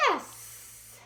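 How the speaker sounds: whispered yes. Acted. Answer to reading child.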